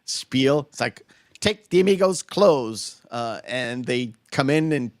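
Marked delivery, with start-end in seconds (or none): 1.4-3.0 put-on old-timey voice. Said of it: "that old-timey voice going".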